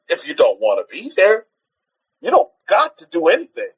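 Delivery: usual speaking voice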